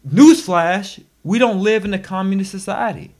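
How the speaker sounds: sarcastic newsflash. Real, not acted.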